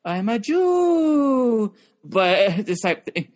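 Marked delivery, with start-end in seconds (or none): 0.0-1.7 high drawn-out whine; Larry David impression. Comic mimicking Larry David's act to his co-host, high and drawn out. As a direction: stretch the last word long and high. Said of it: "Larry David's Larry David. It's like".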